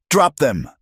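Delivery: a scout ordering the hired men to drop weapons; sharp order. Acted. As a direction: snap it out at the gunmen. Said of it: "Elias ordered".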